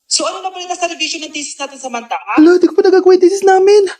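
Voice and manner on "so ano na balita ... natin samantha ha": quiet repeat. Acted. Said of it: "repeated line, much softer".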